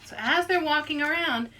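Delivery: ordinary delivery, nothing marked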